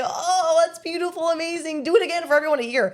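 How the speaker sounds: high, over-the-top gushing; hammed-up fawning praise; mock gushing celebrity. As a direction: gush like a fawning star. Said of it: "fold over and say".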